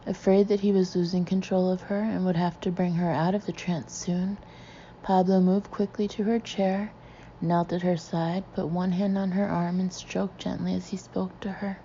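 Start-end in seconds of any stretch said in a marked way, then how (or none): none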